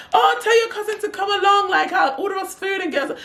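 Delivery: very high, imitated pleading invite; high-pitched, over-keen impression; eager invitation. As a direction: push the offer in a high mock voice.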